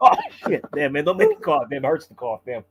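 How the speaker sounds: laughing while trying to hold it in; stifled giggles. Real, not acted. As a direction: choke back the laughter, coughing. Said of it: "I'm trying not to laugh".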